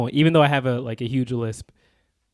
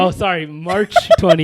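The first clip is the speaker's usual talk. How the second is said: laughing; 4 semitones higher, 6 dB louder than usual. Real, not acted. Laughs at own date mistake.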